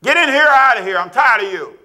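put-on high, loud exasperated yell at a child; a tired parent shouting at a child; irritated shout. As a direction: bark at the kid to get in and get out. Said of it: "It's just a tone".

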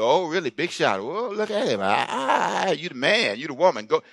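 mocking sing-song jeers with pitch swooping up; teasing. Old friends teasing someone who has grown, mocking. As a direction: heckle like jealous old friends. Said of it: "they tease you".

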